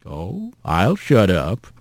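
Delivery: low, soft, slow rumble; calm rough voice. Acted. Mysterious voice answers yelling Rat, low and slow. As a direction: drag it out low and unruffled.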